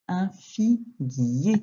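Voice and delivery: slow, drawn-out model reading; syllable by syllable. Shown as a demonstration. Teacher reading a new word to a pupil, syllable by syllable. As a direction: stretch out each syllable for learners.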